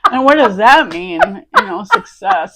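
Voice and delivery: loud, high, talking through laughter; laughing. Real, not acted. Apologizing to the other speaker for a personal-sounding question, laughing. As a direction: apologize while cracking up, voice climbing. Shared laughing fit over success.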